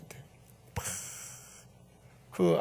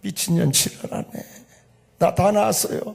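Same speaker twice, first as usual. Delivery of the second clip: scoffing mother; 6 semitones higher, 5 dB louder than usual. Retold or imitated. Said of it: "그랬더니 엄마가".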